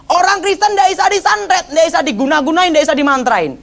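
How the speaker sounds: imitated preacher's proclamation, pitched high; Gilbert. Gilbert khotbah di KKR soal santet, high imitated preaching. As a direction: preach it high, easing down by the last phrase. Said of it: "Tiba-tiba Gilbert khotbah gini".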